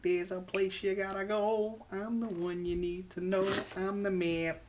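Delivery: put-on high, slow Boston accent singsong. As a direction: sing it in a thick Boston accent. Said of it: "saying words in a Boston accent".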